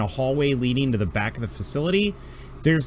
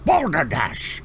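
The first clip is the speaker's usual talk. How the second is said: much higher, fussy put-upon doctor voice; grumpy alien doctor; 9 semitones higher than usual. Acted. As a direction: huff like an overworked old doctor. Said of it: "he's like".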